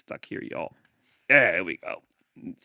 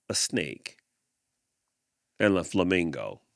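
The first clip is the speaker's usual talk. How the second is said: ordinary delivery, nothing marked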